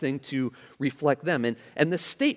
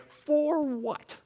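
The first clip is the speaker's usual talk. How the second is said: high, stretched-out indignant question; confrontational disbelief; 12 semitones higher than usual. Acted. Preacher showing how a contentious statement starts, confrontational disbelief. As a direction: challenge them with a drawn-out high pitch. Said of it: "start out a statement that way".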